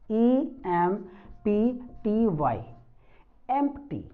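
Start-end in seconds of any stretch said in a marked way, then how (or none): 3.5-4.1 एम्प्टी starting with an e sound, not i; e vowel. Shown as a demonstration. Teacher modelling the word empty, correct e vowel at the start. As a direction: open एम्प्टी on the e of egg. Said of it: "ना कि इम्प्टी".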